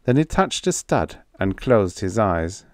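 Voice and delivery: ordinary delivery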